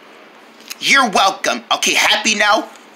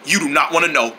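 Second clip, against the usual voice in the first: ordinary talk, nothing marked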